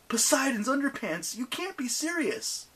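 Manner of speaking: high exasperated exclaim. Acted. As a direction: blurt it in disbelief. Percy exclaiming.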